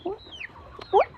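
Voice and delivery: high pet talk. Real, not acted. Talking to the chicken.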